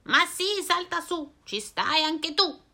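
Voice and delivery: voce più acuta, invitante; strega allegra. Acted. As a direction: accogli l'amico con slancio. (strega allegra, voce acuta)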